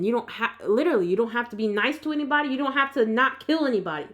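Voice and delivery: ordinary delivery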